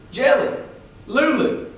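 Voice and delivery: high, loud call across a distance; calling names. A narrator's reading. Uncle calling for the missing children, loud distant call. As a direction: call out for the lost kids. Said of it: "someone calling our names".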